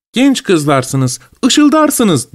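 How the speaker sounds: "ışıldarsınız" sung up high; quoting the pun. Retold or imitated. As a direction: lilt the rhyme to show it off.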